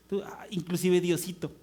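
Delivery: ordinary delivery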